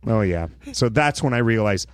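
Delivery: normal talking voice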